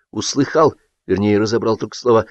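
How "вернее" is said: ordinary delivery, nothing marked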